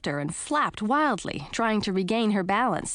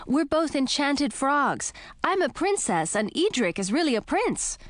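put-on young princess voice; 8 semitones higher than usual. A narrator's reading. Emma explains herself.

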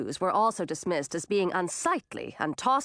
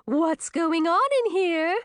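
high, slow, cheery question; put-on cheerful queen voice, pitched up; overly cheerful queen; 9 semitones higher, 4 dB louder, slower than usual. A narrator's reading. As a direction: sing it out with too much cheer. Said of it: "an overly cheerful voice".

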